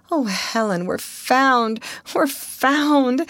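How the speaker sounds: high, mildly emotional cry; overcome with relief. A narrator's reading. Young woman telling her sister they are rescued, overcome. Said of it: "she fell to sobbing".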